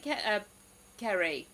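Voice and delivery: normal talking voice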